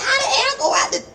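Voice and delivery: very high, puzzled little voice; imitating herself as a questioning child; child talking back. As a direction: squeak it out like a cheeky kid. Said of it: "And I'd be like".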